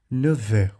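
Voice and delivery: "Neuf heures" with rounded eu, linked by V; French vowel demo. Shown as a demonstration. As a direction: model "Neuf heures" carefully as neu-vër. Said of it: "é o som do de".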